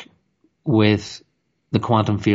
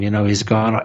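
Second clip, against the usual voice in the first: ordinary talk, nothing marked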